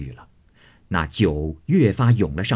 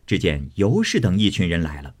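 ordinary delivery, nothing marked

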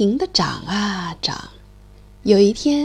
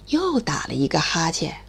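whisper; 8 semitones lower, 4 dB quieter than usual. A narrator's reading. Narrating yawns.

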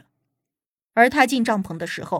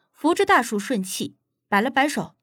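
ordinary delivery

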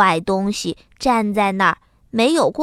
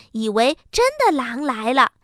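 ordinary delivery, nothing marked

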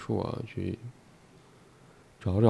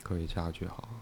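dictating punctuation; 8 dB quieter than usual. Shown as a demonstration.

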